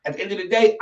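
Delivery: normal talking voice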